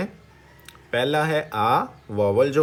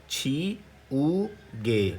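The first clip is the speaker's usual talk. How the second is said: slowly; 4 dB quieter, much slower than usual. Shown as a demonstration. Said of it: "तो तेज़ी से क्या पढ़ेंगे".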